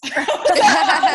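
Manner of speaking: a burst of high, loud laughter. Real, not acted. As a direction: laugh out loud at your own joke. Laughs at her own joke.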